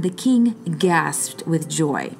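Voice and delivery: usual speaking voice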